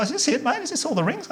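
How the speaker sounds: high, pleading question to the clerk; anxious high asking. Retold or imitated. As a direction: pitch it up with worry.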